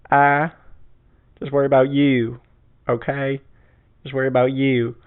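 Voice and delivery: imitating a Southern woman telling the guys off; southern accent. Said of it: "she has, like, the southern accent".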